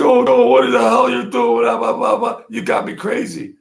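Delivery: high-pitched, loud caricature of careless talker; mock phone ranting. Mocking panicky phone talk of mobsters.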